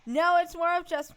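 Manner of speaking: high, loud interjection; insistent retake. Real, not acted. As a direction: raise the voice to take back the floor. Repeats after being talked over.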